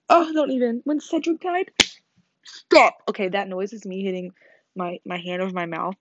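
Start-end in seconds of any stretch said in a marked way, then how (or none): 0.1-3.0 loud. Real, not acted. Overwhelmed remembering Cedric dying.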